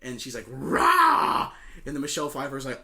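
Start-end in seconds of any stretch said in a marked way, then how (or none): 0.5-1.5 sudden high mock roar; phoenix roar. Friend retelling a movie, Maleficent's mock phoenix roar. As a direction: roar like a risen phoenix bird.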